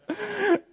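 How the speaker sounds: high-pitched imitated nervous giggle; thin, high laughing fit; nervous laughter. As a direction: mimic laughter you can't control. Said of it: "nervous laughter hit me bad".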